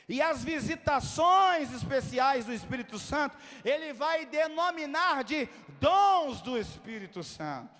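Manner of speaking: loud, projected sermon delivery; full-force preaching. Real, not acted. Preacher teaching the congregation about the Spirit, full-force preaching. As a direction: preach it loudly, driving home key words.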